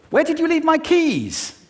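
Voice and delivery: very high agitated question; high pitched. Shown as a demonstration. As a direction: ask it way up high, alarmed. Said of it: "just with pitch".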